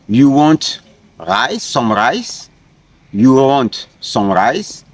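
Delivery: slow, with "rice" lifted at each end; rising question tone. Shown as a demonstration. Teacher translating the example for students, rising question tone on "rice". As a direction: ask by raising the tone on "rice". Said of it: "you can just raise your tone".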